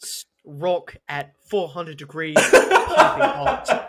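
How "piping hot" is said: laughing squeal. Acted. Laughing mock chef line.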